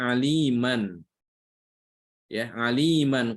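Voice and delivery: slow demo of aliman; saying the word. Shown as a demonstration. Teacher spells out aliman for the students. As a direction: aliman said slowly with the full tanwin n. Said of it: "Contoh ya, pada kata".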